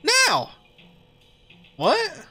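high, eager excited friend; hyper Greg voice. Acted. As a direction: bounce with excitement.

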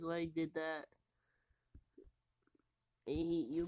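usual speaking voice

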